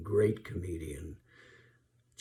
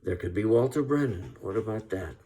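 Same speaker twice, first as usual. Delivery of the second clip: usual speaking voice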